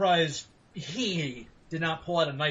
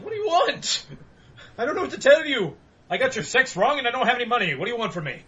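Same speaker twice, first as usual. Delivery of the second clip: exasperated protest; 7 semitones higher, 3 dB louder, faster than usual. Retold or imitated. Exasperated reply to angry stranger.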